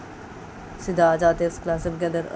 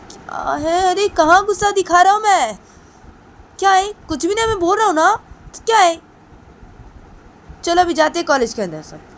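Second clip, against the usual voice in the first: much higher and louder, angrily denying anger; angry shout; 13 semitones higher, 8 dB louder than usual. Acted. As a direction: yell that he isn't angry at all. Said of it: "इतना गुस्सा क्यों दिखा रहा है?". (ज़ोर से गुस्से में चिल्लाना)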